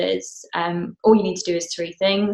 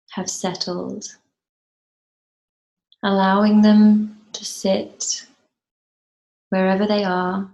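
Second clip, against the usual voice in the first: slow, measured meditation guide with long pauses; guided meditation; 4 dB louder than usual. Acted. Teacher guiding a group to settle and ground, guided meditation. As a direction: lead them gently into stillness, phrase by unhurried phrase.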